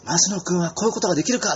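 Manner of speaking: retold playground outburst, high and indignant; imitated angry boy. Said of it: "その子が怒って".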